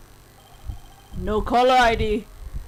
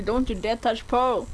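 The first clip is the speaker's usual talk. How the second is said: ordinary talk, nothing marked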